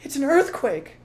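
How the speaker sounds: high, loud alarmed mother fox; alarmed high cry of the fox mother. Acted. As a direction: shriek the news of the quake. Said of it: "cried Missus Fox".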